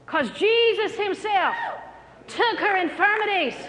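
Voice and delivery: high, ringing proclamation; emphatic preaching. Real, not acted. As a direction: proclaim it to the whole congregation. Preacher proclaims climax of his story.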